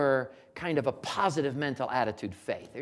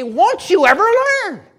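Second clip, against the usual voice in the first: loud, high mock exasperation; hammed-up outburst of lost patience; exasperated; 15 dB louder than usual. What Joseph never said to his brothers, mock exasperated outburst. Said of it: "doesn't even get exasperated and say".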